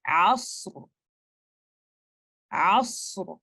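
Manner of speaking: अस्र with a heavy, dark र and full स. Shown as a demonstration. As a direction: recite अस्र, raising the back of the tongue on र. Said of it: "आप इसको इस तरह से पढ़ेंगे".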